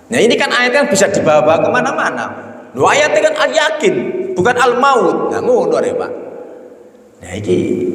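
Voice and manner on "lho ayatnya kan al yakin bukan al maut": mock objector. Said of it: "mocking the misreader".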